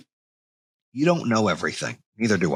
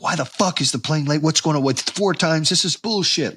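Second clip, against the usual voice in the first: pitched-up mock outburst over a late plane; retold snapping rant; 6 semitones higher than usual. His old self raging at the delayed plane, mock angry. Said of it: "would have snapped".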